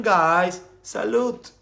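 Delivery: ordinary talk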